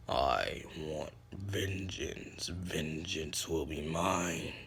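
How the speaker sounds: low, very slow vengeful growl; dark, drawn-out avenger voice. Acted. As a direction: drag out each word deep and menacing. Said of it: "his voice got deeper".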